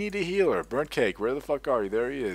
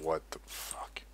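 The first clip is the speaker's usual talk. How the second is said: stunned hushed what the fuck; 5 semitones lower, 12 dB quieter, slower than usual. Retold or imitated. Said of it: "I was just like".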